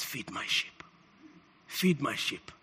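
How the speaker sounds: very low, near-whispered charge; hushed command. Retold or imitated. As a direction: entrust it in a murmur. Acts Jesus' quiet charge to Peter.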